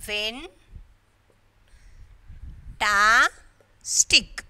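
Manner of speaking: "when ta stick" as three isolated syllables; long pauses between "when", "ta" and "stick". Shown as a demonstration. Teacher showing how syllables are separating, each one said alone. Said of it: "how they are separating is".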